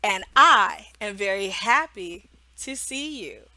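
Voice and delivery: slow, clear, a little laughing; slow teacher intro. Shown as a demonstration. Teacher greeting a young student, slow and clear. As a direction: introduce yourself slowly to the child.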